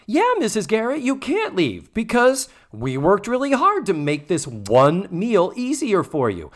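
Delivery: high, snotty mock protest; shittily. Girls telling housemother she can't leave, shitty mock whine. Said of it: "shittily, I will add".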